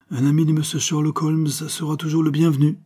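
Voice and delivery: vieil homme jovial, voix plus aiguë; vieillard accueillant. A narrator's reading. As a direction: accueillir l'ami de Holmes avec entrain. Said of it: "dit-il". (Sherman accueille chaleureusement l'ami de Holmes)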